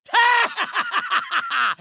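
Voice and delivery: high, loud burst of mad laughter; maniacal villain laugh. Acted. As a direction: cackle like a crazed villain.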